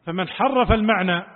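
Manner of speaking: ordinary delivery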